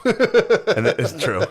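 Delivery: laughing it out, high and loud. Real, not acted. Conceding his friend's joke about boys, laughing. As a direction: concede the point through a real laugh.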